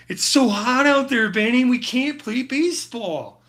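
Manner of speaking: put-on high, loud, slowed kid whine; hammed-up kid complaining about the heat; movie kid quote. Quoting a movie kid line.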